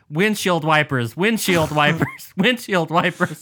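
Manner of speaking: loud, slow, spaced-out repetition; talking to voice assistant. Retold or imitated. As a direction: call each word out slowly to the remote. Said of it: "I've been yelling at my remote".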